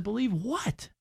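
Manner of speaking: high, hushed question back to the coworker; puzzled quiet question. Retold or imitated.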